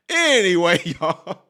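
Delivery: laughing through the words after his own joke. Real, not acted. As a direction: break into a laugh on anyway. Laughs after Trump wig joke.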